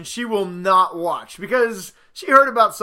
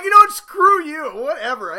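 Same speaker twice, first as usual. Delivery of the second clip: higher, louder hammed-up retort; mock angry snap; 8 semitones higher, 6 dB louder than usual. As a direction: fire back in a petty huff. He snaps back in fight.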